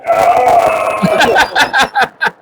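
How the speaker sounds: sudden mock yell, high-pitched; jump-scare yell into the bathroom. Dad yells into the girls' bathroom to scare. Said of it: "I just yelled".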